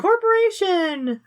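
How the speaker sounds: drawn-out, slow mocking cheer; mock excited exclamation. Real, not acted. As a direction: announce it slowly like a triumphant discovery. Mocking plot callback.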